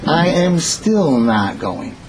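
drawn out word by word, very slow; a slow, deliberate refusal. Acted. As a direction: dig in and refuse, stretching every word. Moses flatly refusing God, acted.

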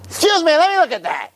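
put-on high, pushy old lady butting in. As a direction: squeeze past everyone in a high old lady voice.

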